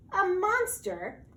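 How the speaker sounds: high, slow mocking question; scoffing disbelief. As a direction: scoff at the very idea. Rabbit scoffs at the monster.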